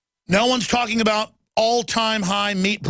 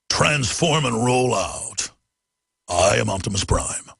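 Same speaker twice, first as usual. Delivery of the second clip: much lower, very slow robot growl; Optimus Prime impression; 7 semitones lower, slower than usual. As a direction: rumble it out like a giant robot. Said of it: "What about an Optimus Prime?".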